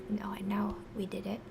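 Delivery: whispered aside to self; whispering. Real, not acted. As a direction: murmur it in a whisper. Muttering to self while writing.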